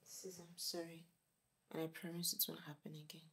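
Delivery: soft, contrite promise; subdued apology. Acted. Younger sister to angry sister, softly contrite. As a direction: apologise in a small, quiet voice.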